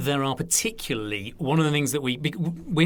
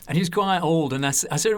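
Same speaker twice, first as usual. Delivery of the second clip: ordinary talk, nothing marked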